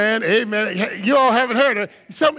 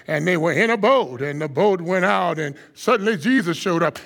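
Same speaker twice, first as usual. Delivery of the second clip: low, calm preacher opening a sermon; deep, unhurried preacher voice; slow deep preacher; 9 semitones lower, 3 dB quieter than usual. Shown as a demonstration. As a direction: start the sermon low and easy. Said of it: "nice, deep voice".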